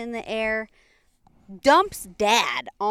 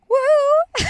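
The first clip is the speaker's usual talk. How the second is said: high, loud gleeful whoop; mock whoop; 9 dB louder than usual. Teller voices mule joining a buck party, high loud whoop. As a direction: let out a big party whoop.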